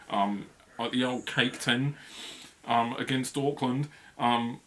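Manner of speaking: slow, lightly put-on Kiwi accent; a hint of a New Zealand accent. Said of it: "I'll stop doing a Kiwi accent".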